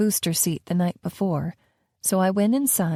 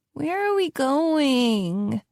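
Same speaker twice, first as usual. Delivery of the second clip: put-on higher, slow, drawn-out child's whine; a bored kid whining from the back seat; 6 semitones higher, much slower than usual. Maya whining at the nanny from the back seat, slow and higher. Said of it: "Maya whined".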